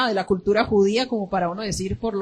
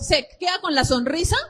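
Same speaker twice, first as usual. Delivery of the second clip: normal talking voice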